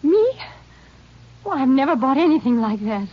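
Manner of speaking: high, loud startled yelp; indignant, startled wife; shocked denial. Acted. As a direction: blurt it out, caught off guard. Accused, she denies shocked.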